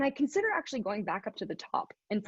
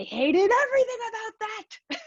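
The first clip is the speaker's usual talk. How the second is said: much higher, hammed-up exasperation; exasperated outburst; 11 semitones higher than usual. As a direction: blurt out your relief and disgust. Coming out of the ice.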